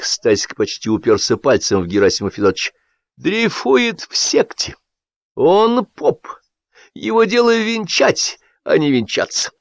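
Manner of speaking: expose him before everyone; agitated accusation. A narrator's reading. Stasik denouncing Gerasim to the guests, agitated.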